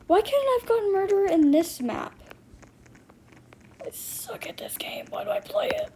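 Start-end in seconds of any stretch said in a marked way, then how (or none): 3.8-5.9 breathy whispered self-pity. Real, not acted. Gamer whispers to herself after a bad round. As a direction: whisper it to yourself, defeated.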